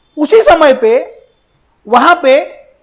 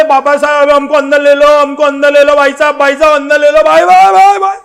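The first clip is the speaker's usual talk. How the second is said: mock crowd clamour, repeated without a break; imitated pleading crowd; 4 dB louder than usual. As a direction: plead over and over, climbing to a peak. Crowd begging to be let in.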